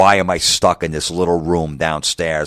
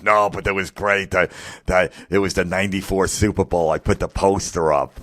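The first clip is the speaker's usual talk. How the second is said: a hint of a goofy put-on fan voice; man cave guy voice. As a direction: play the sheepish guy defending his poster.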